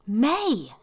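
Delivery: high, loud screech of her name. Acted. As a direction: shriek in alarm. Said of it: "Lucky screeched".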